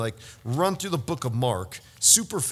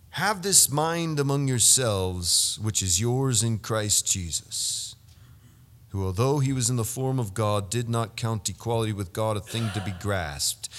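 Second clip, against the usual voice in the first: scripture reading; 5 semitones lower, 3 dB quieter than usual. Acted. Preacher reading Philippians to the congregation, reverent. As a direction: read the verse with care.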